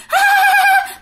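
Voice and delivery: loud, very high mocking ghost laugh; ghost's shrill laugh. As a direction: cackle down the phone line. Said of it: "Mà cười há há há". (tiếng ma cười the thé)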